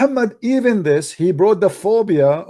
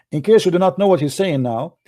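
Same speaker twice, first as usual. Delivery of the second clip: ordinary delivery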